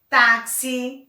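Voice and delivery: táxi with a strong first syllable; stress on TÁ. Shown as a demonstration. Teacher shows an unwritten strong syllable, stressing the start of táxi. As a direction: punch the tá of táxi. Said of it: "Mas o tá é o mais forte".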